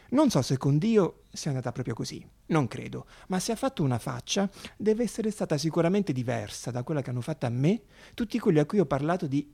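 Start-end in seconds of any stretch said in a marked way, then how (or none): none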